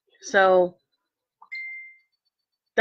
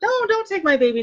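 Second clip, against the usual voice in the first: much higher mock plea not to take the baby; a pitched-up, half-playful plea; pleading mother; 12 semitones higher than usual.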